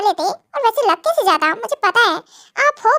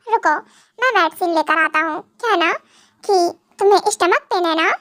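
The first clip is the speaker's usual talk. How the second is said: ordinary talk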